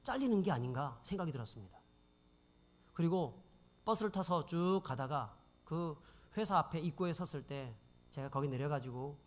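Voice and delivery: usual speaking voice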